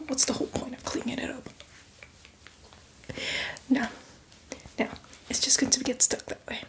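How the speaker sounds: normal talking voice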